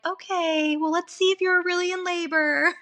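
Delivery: high, sweetly skeptical nurse voice; mock sugary nurse; doubtful nurse. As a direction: humor her, sure she's not in labor. Nurses doubting her labor.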